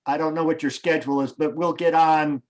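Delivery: usual speaking voice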